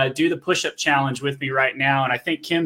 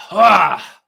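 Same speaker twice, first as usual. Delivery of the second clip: loud gasp after push-ups; strained exhale; 5 semitones higher, 6 dB louder than usual. Real, not acted. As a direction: let out a hard breath of effort. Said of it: "man, my face got red".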